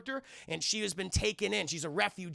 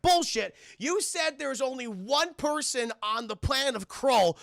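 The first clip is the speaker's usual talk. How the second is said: raised, louder accusing outburst; mock indignant challenge; 6 semitones higher, 3 dB louder than usual. Companion challenging the old man's story, mock indignant outburst. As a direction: call him out in hammed-up outrage.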